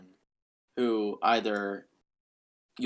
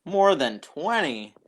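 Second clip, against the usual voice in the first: higher, louder, slow disbelief; surprised; 6 semitones higher, 5 dB louder, slower than usual. Real, not acted. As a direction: drag it out in disbelief. Repeats question in disbelief.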